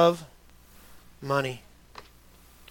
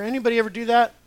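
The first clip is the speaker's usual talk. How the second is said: ordinary delivery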